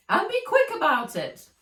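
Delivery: high, loud impatient order; impatient Fred. A narrator's reading. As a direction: snap at her to hurry. Fred bossing assistant.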